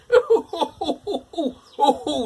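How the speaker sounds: very high, loud ho-ho laughter; pigs laughing. Acted. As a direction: laugh triumphantly in a squeaky voice. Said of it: "laughed the two little pigs".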